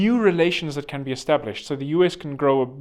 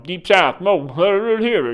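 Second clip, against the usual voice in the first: garbled mock Deep South accent; 3 semitones higher, 5 dB louder, slower than usual. Comic teasing the Deep South, garbled Southern accent. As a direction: slur it like a parody Southerner. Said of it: "you guys talk like that".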